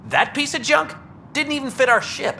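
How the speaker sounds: scoffing disbelief. Acted. Scavenger scoffs at the stolen thruster.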